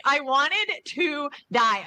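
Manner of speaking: slow dramatic confession of embarrassment; slow, emphatic repeat. Real, not acted. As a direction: stretch every word for dramatic mortification.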